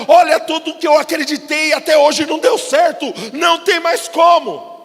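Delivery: loud, high, desperate cry of a broken man; despairing shout. Acted. Preacher shouting Ezekiel's despair to the congregation.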